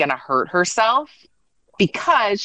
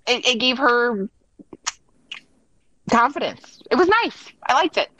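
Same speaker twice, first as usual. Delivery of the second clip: high-pitched, emotional and halting; moved, with pauses to collect yourself; touched; 5 semitones higher than usual. Real, not acted. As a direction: voice lifting high as the feeling hits. Moved recalling tearful goodbye, pauses.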